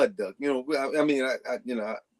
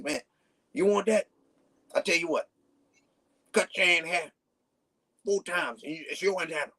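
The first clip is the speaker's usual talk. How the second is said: lightly raised, teasing mentor voice; imitating Cool Breeze; 3 semitones higher than usual. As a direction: tease the kid with the offer. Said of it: "That's how he talked".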